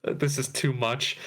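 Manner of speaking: ordinary delivery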